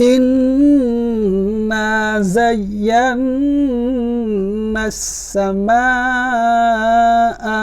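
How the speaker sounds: very slow chant, long held nasals and vowels; elongated Quranic recitation. Shown as a demonstration. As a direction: join the words, stretching each long sound. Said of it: "আমরা টানবো এখন সবটা একসাথে". (টেনে টেনে একসাথে তিলাওয়াত)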